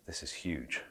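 put-on hushed, awestruck boy's whisper under his breath; awed whisper. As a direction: breathe it like a boy hiding in the trees. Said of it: "teenage boy, two trees over, listening in, going".

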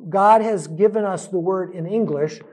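usual speaking voice

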